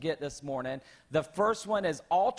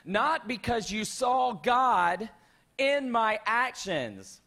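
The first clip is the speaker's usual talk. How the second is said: slow quote; 3 semitones higher, slower than usual. Retold or imitated. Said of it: "He says".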